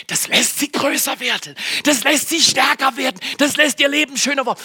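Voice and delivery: hohe, drängende Predigerstimme; leidenschaftliches Predigen. Real, not acted. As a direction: jede Zeile weiter steigern. Steigert die Predigt rhetorisch. (leidenschaftlich predigend)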